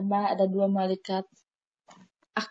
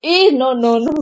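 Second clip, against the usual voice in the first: flustered protest; 3 semitones higher, 11 dB louder, slower than usual. Real, not acted. Embarrassed protest at teasing.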